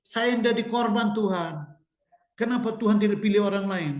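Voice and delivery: high-pitched mock crying plea; sustained high whine of complaint; imitated tearful prayer. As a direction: wail plaintively at God, imitating someone in tears. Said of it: "tiba-tiba dia nangis".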